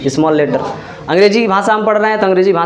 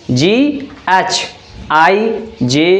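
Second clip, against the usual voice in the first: ordinary talk, nothing marked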